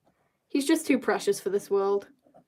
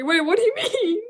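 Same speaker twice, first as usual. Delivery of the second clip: high, louder laughing disbelief; pitched way up, baffled and amused; incredulous; 10 semitones higher, 4 dB louder than usual. Real, not acted. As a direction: burst out in laughing protest. Mock outrage at chat comment.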